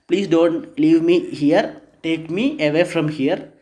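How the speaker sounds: ordinary delivery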